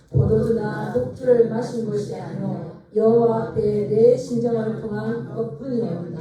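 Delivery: loud, slow, solemn reading of the verse; scripture reading aloud. Shown as a demonstration. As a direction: read the verse out slowly for the congregation. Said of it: "우리 십오 절 말씀 읽어보겠습니다".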